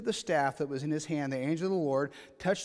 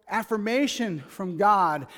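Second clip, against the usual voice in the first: ordinary talk, nothing marked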